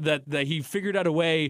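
ordinary delivery

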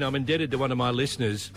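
ordinary delivery

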